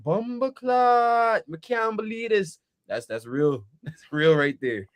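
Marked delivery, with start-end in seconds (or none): 0.0-2.6 higher, slow Jamaican imitation; slow, amazed Jamaican lilt; Jamaican accent. Friend imitating the coworker's praise, Jamaican accent. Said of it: "when he go like".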